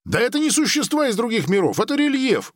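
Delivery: high-pitched, hurried outburst; crying out. A narrator's reading. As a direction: blurt it out fast and high, an acted exclamation. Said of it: "выкрикнул Грик".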